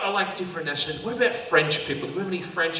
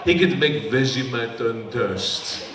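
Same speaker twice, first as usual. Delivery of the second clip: put-on slow, low French accent; Manu; 6 semitones lower, slower than usual. Comic as TV chef women adore, French accent. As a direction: seductive French accent, drawn out. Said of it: "You just wanna hear his voice".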